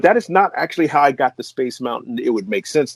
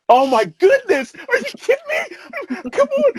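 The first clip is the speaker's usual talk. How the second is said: much higher, slow and amazed; can't believe your luck; squealing with delight at the news; overjoyed disbelief. Real, not acted. Overwhelmed joy.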